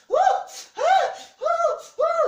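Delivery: very high, loud whoops; imitated burst of sharp falsetto shrieks; shrieking yelps. Wife yelping in the bathroom after burning drops, shrieking.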